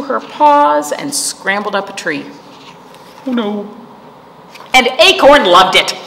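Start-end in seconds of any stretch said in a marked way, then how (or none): none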